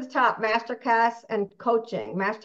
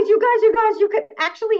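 excited; 12 semitones higher, 7 dB louder, faster than usual. Real, not acted. Excited call to audience.

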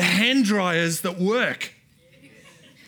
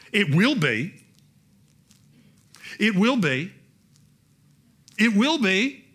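louder each time, rising to a declaration; building refrain; 4 semitones higher, 6 dB louder than usual. Acted. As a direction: build the promise step by step.

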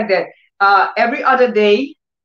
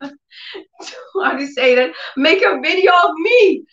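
ordinary talk

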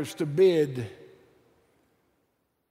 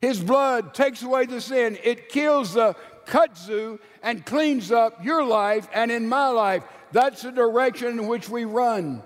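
fiery preaching; 6 semitones higher than usual. Acted. Preacher rallying the congregation, full-force preaching. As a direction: lift the cross up with full-voiced fervor.

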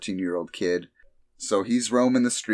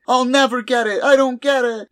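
much higher, louder crying whine; mock sobbing wail; 7 dB louder than usual. Crying T cell running off, high mock wail. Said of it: "And he's like".

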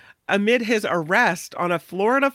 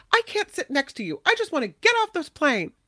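high pleading plea, much higher; upset passenger; 9 semitones higher than usual. Retold or imitated. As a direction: whine it like a fed-up passenger. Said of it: "one of them wanted to get off the plane".

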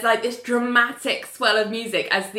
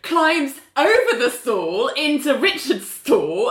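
usual speaking voice

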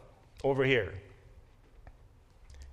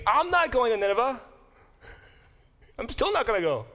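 higher, louder stubborn protest; defiant refusal; 8 semitones higher, 4 dB louder, faster than usual. Acted. Teacher voicing Jonah refusing to go to Nineveh, defiant. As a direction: dig in your heels and refuse.

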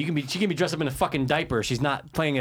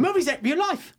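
high-pitched heated comeback; argumentative retort. Real, not acted. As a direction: argue back, pitch right up. Co-host arguing back.

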